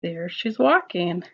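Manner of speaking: ordinary delivery, nothing marked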